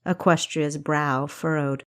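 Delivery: plain reading voice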